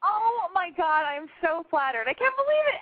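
usual speaking voice

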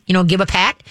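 ordinary talk, nothing marked

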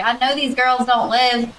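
a hint of a mocking Southern listener; mock Southern accent. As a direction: imitate a listener with a thick Southern twang. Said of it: "They're gonna be like".

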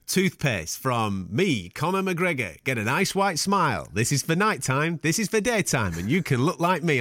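staccato. Retold or imitated. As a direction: stiff halting ad-read voice, word by word. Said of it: "it's so staccato".

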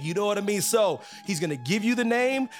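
ordinary talk

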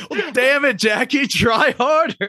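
high-pitched mock exasperated shout; mock scolding. Podcast host jokingly telling off Jackie, mock scolding. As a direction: scold her in a high, drawn-out cry.

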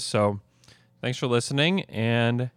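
ordinary talk, nothing marked

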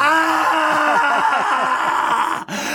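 high, loud frantic ranting; frantic shout. Acted. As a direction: yell it like a lovesick tantrum. Reads ranting letter in outburst. (半泣きで叫ぶ)

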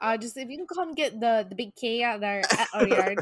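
put-on light Minnesota accent; lightly voiced Minnesota nice neighbor.

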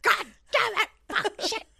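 very high, loud comic swearing; hammed-up exasperated yelling; mock military cursing. Military guys cursing at the emus, high mock-frustrated yell. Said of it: "all the military guys are like".

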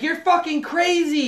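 high-pitched mock yell at her mother; retold exasperated yell. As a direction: imitate a fed-up girlfriend, high and yelling. Girlfriend yelling back at mother.

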